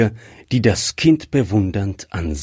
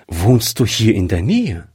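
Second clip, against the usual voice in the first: ordinary delivery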